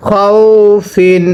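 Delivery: খাউফিন stretched very slowly; teacher models খাউফিন slowly; slow drawn-out reading. Shown as a demonstration. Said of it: "আবার পড়বেন".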